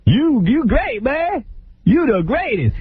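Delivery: high-pitched gushing Bobby voice; squeaky mock Bobby praising him; [name] impression. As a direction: gush like an overexcited fan, way up high.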